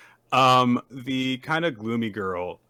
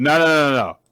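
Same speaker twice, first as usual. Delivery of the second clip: loud, higher repeated no; emphatic refusal; 5 semitones higher, 9 dB louder than usual. Retold or imitated. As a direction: shut the idea down loudly. Instructor refusing the students.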